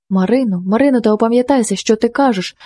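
usual speaking voice

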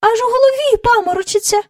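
quick, very high-pitched echo; echoing courtiers. A narrator's reading. Courtiers all echoing their king's complaint, high and quick. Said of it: "луною підхопили всі придворні".

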